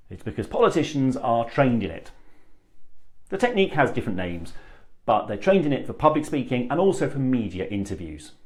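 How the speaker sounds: ordinary talk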